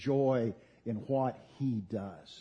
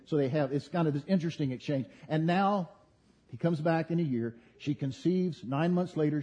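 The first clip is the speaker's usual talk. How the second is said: usual speaking voice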